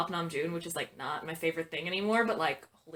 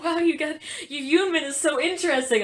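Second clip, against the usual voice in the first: gushing fan; 8 semitones higher, 6 dB louder than usual. Retold or imitated. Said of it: "They're like".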